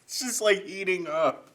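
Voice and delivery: high, loud laughter through the sting; laughing with a burning mouth. Real, not acted. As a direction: laugh while your mouth burns. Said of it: "my mouth is just like".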